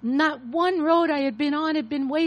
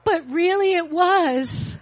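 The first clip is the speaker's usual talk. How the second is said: crying; 4 semitones higher, 7 dB louder, slower than usual. Real, not acted. Confessing, voice breaks crying.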